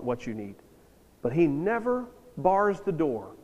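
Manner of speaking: usual speaking voice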